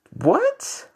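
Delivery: high, stretched disbelieving what; incredulous what. Real, not acted. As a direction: squeak it out in disbelief.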